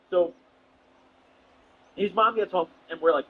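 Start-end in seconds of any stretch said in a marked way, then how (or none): none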